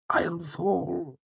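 high, hushed and fragile; whispering a fear of the bed; soft frightened. Acted. Wynne tells Elodin softly he'll fall off the bed. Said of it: "he said softly".

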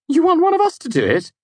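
a high-pitched young man's voice, lightly mocking; a friend teasing Kvothe about testing the doll, voiced high; Simon voiced. A narrator's reading. Simon's voice in read scene.

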